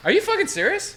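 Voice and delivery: can't believe the great news; squealing with excitement; excited disbelief. Real, not acted. Excited reaction to revamp news.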